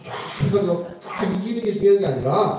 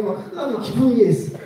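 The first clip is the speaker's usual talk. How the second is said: normal talking voice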